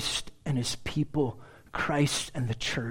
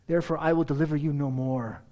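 ordinary delivery

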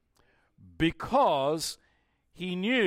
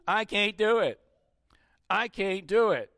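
put-on higher, whining little-kid voice; child giving up; 5 semitones higher than usual. Preacher voicing a child who fails a new skill, whiny. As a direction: sound like a frustrated kid quitting.

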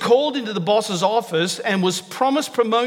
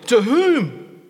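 sharp, louder demand; sharp question; 4 semitones higher, 4 dB louder than usual. A narrator's reading. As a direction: snap the question at him. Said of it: "He asked sharply".

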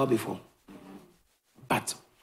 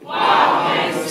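said louder on request; 7 dB louder than usual. Shown as a demonstration. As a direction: call it back louder. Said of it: "Say it louder".

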